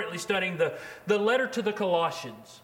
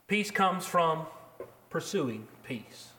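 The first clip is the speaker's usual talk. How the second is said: "pursuing" drawn out slowly and dropped much lower and softer; emphasis on pursuing; 4 semitones lower, 4 dB quieter, slower than usual. Shown as a demonstration. Preacher to his congregation, slow hushed weight on "pursuing". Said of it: "I emphasize pursuing there".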